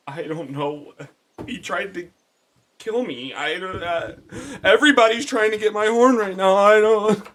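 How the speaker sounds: high voice building to a loud wail; panicked unicorn. Acted. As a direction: plead in rising distress. Unicorn panics about being hunted.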